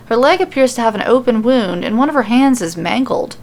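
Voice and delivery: plain reading voice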